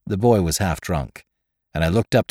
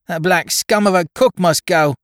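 put-on high, louder boyish cursing; swearing roundly; 9 semitones higher, 4 dB louder than usual. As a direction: spit out the curse like a brat. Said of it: "he swore roundly".

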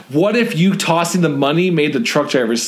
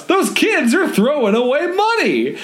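high slow call; 10 semitones higher than usual. A narrator's reading. Passerby shouting to crowd.

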